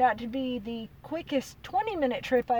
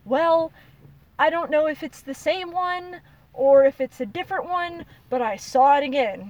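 agitated retelling; 4 semitones higher, 7 dB louder than usual. Retold or imitated. Retelling seeing the creature again.

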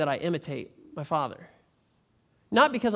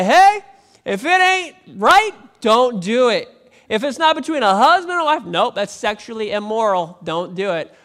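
shouted, much higher and louder, blunt; Paul's blunt shout; 5 semitones higher, 10 dB louder than usual. Retold or imitated. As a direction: bark it out like a fed-up apostle. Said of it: "He was like".

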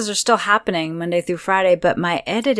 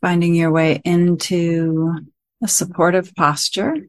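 guided meditation; 7 dB louder than usual. Acted. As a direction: guide listeners gently into stillness, phrase by phrase.

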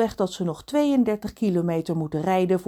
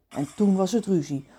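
ordinary delivery, nothing marked

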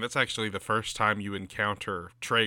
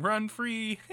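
mocking taunt; 11 semitones higher than usual.

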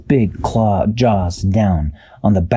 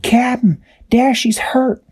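high, bright little character voice; Tweak's voice. A narrator's reading. Tweak to crew, high worried character voice. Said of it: "Tweak said".

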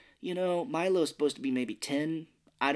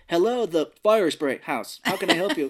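mock shop greeting; 5 semitones higher, 8 dB louder than usual. Milo answering the phone with a fake shop greeting. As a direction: answer like a cheesy shop clerk. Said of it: "if he's like".

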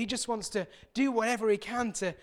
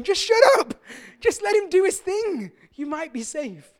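loud, high exasperated yell at the TV; shouting at the telly; 10 semitones higher, 10 dB louder than usual. Retold or imitated. Fed up with contestants, yelling at the TV screen. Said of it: "I'm shouting at the telly going".

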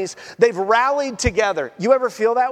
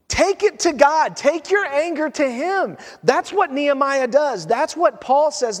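ordinary talk

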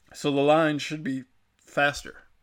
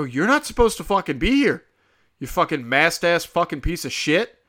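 retold angry outburst, pitched up and louder; losing it; 3 semitones higher, 5 dB louder than usual. Man to woman at self-checkout, losing his temper. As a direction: re-enact tearing into a stranger. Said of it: "I'm losing my fucking mind".

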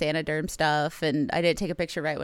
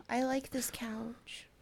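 whispering; 4 semitones higher, 11 dB quieter, slower than usual. Real, not acted. Defending her couch, whispered.

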